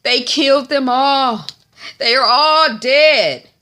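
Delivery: loud, high-pitched dramatic announcement; town crier alarm. Retold or imitated. Man from the away group breaking news, crying out. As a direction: cry out the bad news, drawing out the last words. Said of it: "the town crier".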